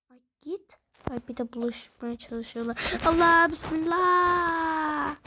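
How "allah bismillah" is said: slow, loud, stretched-out cry; drawn-out shout. Real, not acted. Gamer dodging enemies, slow drawn-out shout. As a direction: shout it long as danger comes.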